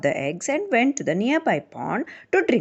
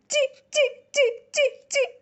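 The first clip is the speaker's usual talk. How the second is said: very high chee chirps; chirping; much faster than usual. Pretend. Storyteller chirps like a baby bird, very high. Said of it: "Their voices also sounded different".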